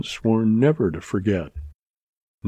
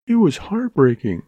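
a touch of a sob in a higher voice; half sobbing; 7 semitones higher than usual. A narrator's reading. Mary telling Dan of her father's ruin, half sobbing. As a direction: let the voice catch, understated. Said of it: "she half sobbed".

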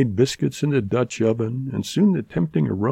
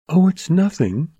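put-on higher, light dismissive girl voice; Mary brushing it off; 8 semitones higher than usual. A narrator's reading. Mary hiding her surprise from Dan, lightly dismissive. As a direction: shrug it off as the young woman. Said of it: "she replied".

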